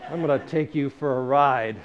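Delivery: loud, commanding voice from above; voice of God. Retold or imitated. God promising the writer a wild ride, loud commanding voice. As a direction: announce it boldly, like God to a buddy. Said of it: "the voice came back to me and said".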